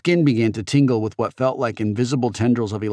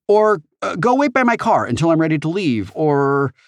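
put-on impatient teen boy; 8 semitones higher, 4 dB louder than usual. A narrator's reading. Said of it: "Og said".

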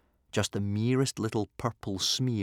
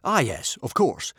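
higher-pitched knowing agreement; 5 semitones higher, 4 dB louder than usual. A narrator's reading. Chiefs pretending they knew it all along, knowing agreement.

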